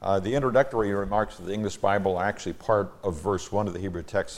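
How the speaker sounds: usual speaking voice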